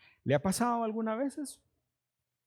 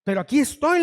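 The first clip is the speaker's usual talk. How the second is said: aquí estoy, fuerte; 5 semitones higher, 6 dB louder, faster than usual. Retold or imitated. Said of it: "le digo yo".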